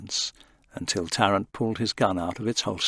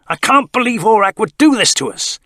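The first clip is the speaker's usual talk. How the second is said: higher and louder, furious disbelief; angry outburst; 11 semitones higher, 10 dB louder than usual. A narrator's reading. Tarrant after kicking a chair, angry outburst. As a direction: let the betrayal explode.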